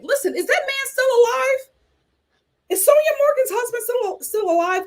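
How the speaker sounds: incredulous. Real, not acted. Incredulous question about old husband.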